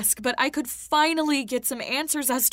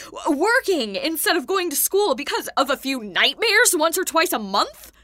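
louder, heated pushback; indignant, raised-voice protest; upset arguing; 2 semitones higher, 6 dB louder than usual. Acted. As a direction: fight back at dad, voice raised. Arguing with dad.

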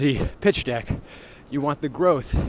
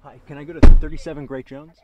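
calling out; 13 dB louder than usual. Real, not acted. Calling to cab driver.

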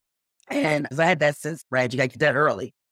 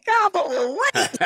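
flustered surprise; 3 dB louder, slower than usual. Retold or imitated. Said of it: "I said".

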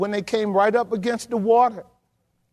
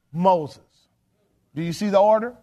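ordinary talk

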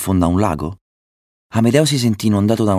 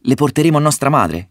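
raised, higher-pitched storybook exclamation; eager exclamation; 7 semitones higher, 3 dB louder than usual. A narrator's reading. Ulisse wants to bring food home to mother, eager exclamation. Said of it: "esclamò Ulisse".

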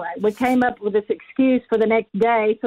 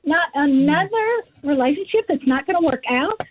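retold anguished plea; 6 semitones higher than usual. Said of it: "crying out to God and saying".

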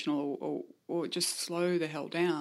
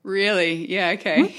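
laughing; 3 semitones higher, 8 dB louder than usual. Real, not acted. Laughs at swearing story.

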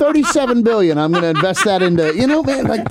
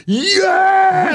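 yelling yeah; 10 semitones higher than usual. Retold or imitated. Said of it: "you'd have heard me going".

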